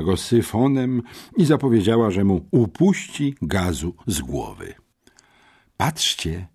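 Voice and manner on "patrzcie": high, gossipy schoolgirl cry; exclaiming. A narrator's reading. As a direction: call the others to look, voice jumping up. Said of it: "wykrzyknęła". (podniecony okrzyk plotkującej uczennicy)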